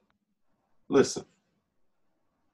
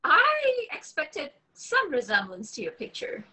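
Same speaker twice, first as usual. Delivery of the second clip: put-on a young woman sizing up her date, pitched high; young woman's voice. Woman meeting her blind date, high and wary.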